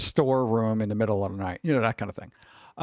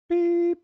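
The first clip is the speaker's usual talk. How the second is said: beep noise; 4 dB louder than usual. Pretend. Imitated beep.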